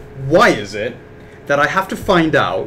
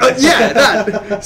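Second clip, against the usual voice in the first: laughing; 8 semitones higher, much slower than usual. Retold or imitated. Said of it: "I'm like".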